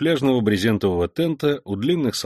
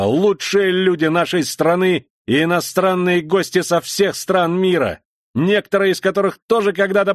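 shouting drill sergeant; 8 semitones higher, 4 dB louder than usual. Acted. Lieutenant harangues the veterans.

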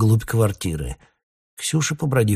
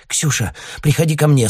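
high, loud, breaking panic, rising on срочно; broken panicked plea; 7 semitones higher, 6 dB louder than usual. A narrator's reading. Alla calls her sister in panic, broken panicked voice. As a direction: gasp it out in terror, climbing higher. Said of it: "ломано выговорила Алла".

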